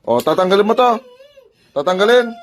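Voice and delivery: loud, high, rising challenge; forceful demand. Real, not acted. Healer asking spirit if it will remove it, forceful demand.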